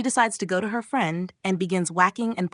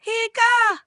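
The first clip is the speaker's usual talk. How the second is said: high, loud yelp of fright; startled shriek; 6 dB louder than usual. A narrator's reading. Brother startled by the friend's evil look, shrieking. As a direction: jump out of your skin. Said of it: "as he jumps out of his skin".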